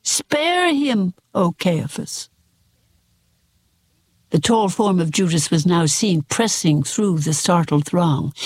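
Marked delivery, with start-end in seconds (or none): 0.0-2.3 hoarse cry. A narrator's reading. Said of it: "a hoarse voice rang through the hall".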